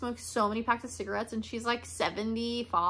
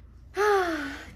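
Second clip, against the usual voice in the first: high audible sigh; real sigh; 6 semitones higher than usual. Real, not acted. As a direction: let out a big sigh. Sighs before a serious question.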